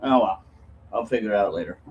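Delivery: usual speaking voice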